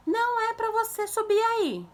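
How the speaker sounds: high, loud, drawn-out reprimand; parent's scolding shout. Shown as a demonstration. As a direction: scold a climbing child from afar. Models parent scolding climbing child. (bronca de mãe, aguda, alta e arrastada)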